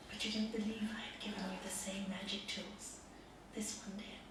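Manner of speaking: soft whispered reveal. Real, not acted. Preacher to the congregation, whispering the lesson. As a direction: whisper it as a secret truth. Master's point, hushed.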